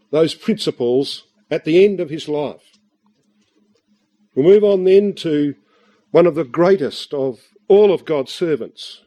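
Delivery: ordinary delivery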